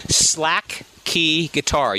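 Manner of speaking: very slow, drawn-out "Slack key guitar"; said slowly. Shown as a demonstration. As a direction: stretch out each word of "Slack key guitar". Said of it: "You have to say it slowly".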